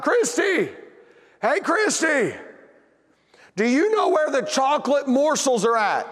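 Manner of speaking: high, drawn-out call to another room; hollering. Retold or imitated. Dad calling his wife in another room, hollering. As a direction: holler for someone across the house. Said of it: "I hollered out".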